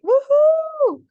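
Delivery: high, loud whoop then yeah; excited cheering. Shown as a demonstration. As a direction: celebrate like you've already won. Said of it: "gets you hyped up into that excited state".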